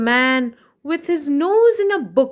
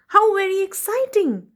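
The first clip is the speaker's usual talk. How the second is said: bright rising delight, higher; excited; 8 semitones higher than usual. Acted. Storyteller to children, thrilled and higher.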